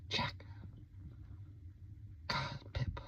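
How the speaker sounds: breathy unvoiced whisper; barely audible curse; whispered insult.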